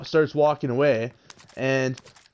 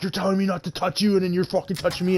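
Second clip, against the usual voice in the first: high, rapid mock outrage; freaking out; 7 semitones higher, faster than usual. Imitating the kid protesting being touched, freaking out. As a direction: imitate an indignant, rushed high-pitched rant. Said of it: "he starts freaking out".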